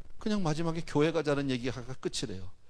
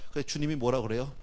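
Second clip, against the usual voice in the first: ordinary talk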